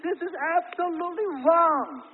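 high, loud and slow protest; quoted vehement protest. Retold or imitated. Preacher voicing the brother's protest, slow, high and loud. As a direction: drag it out like a man outraged.

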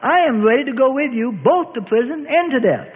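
a lightly voiced Peter insisting he's ready; insisting, protesting. A narrator's reading. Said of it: "protested and insisted".

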